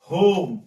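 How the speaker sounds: होम loud, with a long rounded 'oh'; long o sound. Shown as a demonstration. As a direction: stretch the 'oh' in होम and project it. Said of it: "ओ की मात्रा पढ़ेंगे". (होम में लंबी ओ की आवाज़, ज़ोर से)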